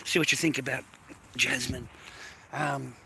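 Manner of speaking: normal talking voice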